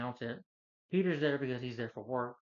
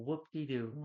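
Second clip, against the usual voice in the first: usual speaking voice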